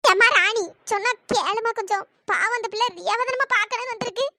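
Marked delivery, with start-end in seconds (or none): none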